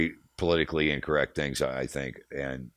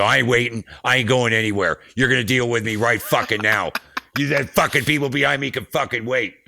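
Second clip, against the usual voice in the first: loud, raised-pitch angry refusal; fired up; 6 semitones higher, 8 dB louder than usual. Retold or imitated. Roadie refusing to wait for the border cop, fired up. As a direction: reenact a defiant blow-up at an officer. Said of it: "get all fired up".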